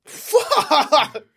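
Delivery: loud startled swear breaking into a laugh; laughing shocked curse. Real, not acted. Host hearing Andy Griffith punched a wall, laughing shock. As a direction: blurt it out through a laugh, caught off guard.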